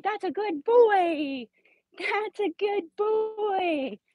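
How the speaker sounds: high, slow sing-song praise; high-pitched dog praise. Real, not acted. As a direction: coo it slowly up high to the dog.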